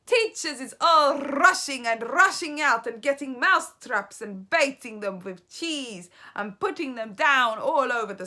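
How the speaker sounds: loud, high crowing witch voice; Grand High Witch gloating to her audience. A narrator's reading. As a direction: gloat over the plan.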